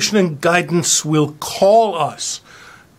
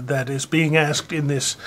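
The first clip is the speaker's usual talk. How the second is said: usual speaking voice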